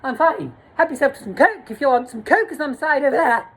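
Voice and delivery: a hammed-up flustered host; high camp host. Retelling a high host offering tea, camp voice. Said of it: "He's like".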